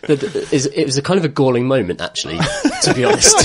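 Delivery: words breaking up into giggles; laughing while talking. Real, not acted. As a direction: laugh through the admission. Amused at the free house.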